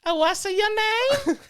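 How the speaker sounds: very high, loud, stretched question; imitated coworker. Coworker finally asking the driver his name, high loud mock voice. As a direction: squeal it slowly as the coworker. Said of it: "And she's like".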